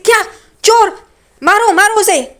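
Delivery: high, loud acted alarm; shocked outcry. Renuka hears of a thief, acted shocked rallying cry.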